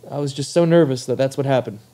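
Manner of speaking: ordinary talk, nothing marked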